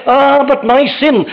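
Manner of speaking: mock the worried sinner's cry; anxious believer. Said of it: "you say".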